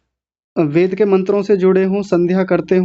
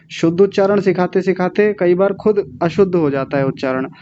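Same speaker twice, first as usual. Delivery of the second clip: ordinary delivery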